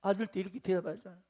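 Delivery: ordinary delivery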